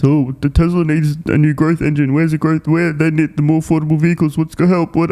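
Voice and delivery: high, whiny mock-panic voice of the critics; mocking doom-monger analysts. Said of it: "yet so many D M Fs".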